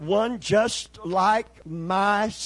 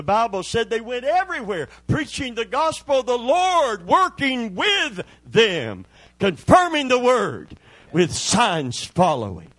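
louder preaching, slowing on the last words; full-force preaching; 5 semitones higher, 5 dB louder than usual. Acted. Preaching climax.

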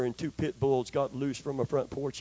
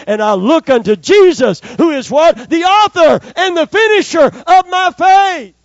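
high, loud climactic preaching; preaching at full force; 17 dB louder than usual. Acted. Preacher rousing the congregation, shouting. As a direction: build to a ringing climax.

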